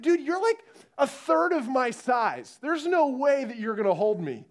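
high-pitched, incredulous protest; acted exasperated doubt; mock disbelief. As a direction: object like you don't trust him one bit. Nervous climber answering tiny helper.

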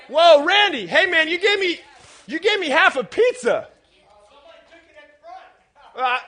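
loud, high-pitched staged indignation; mock outrage. Customer confronting the delivery man over half a pizza, mock outrage. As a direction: protest in playful disbelief, raising your voice.

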